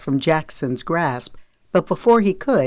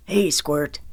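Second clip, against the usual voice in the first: usual speaking voice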